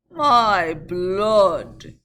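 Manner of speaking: loud vexed curse. Acted. Vexed coachman cursing the slow climb, loud. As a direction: spit it out in annoyance. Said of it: "ejaculated the vexed coachman".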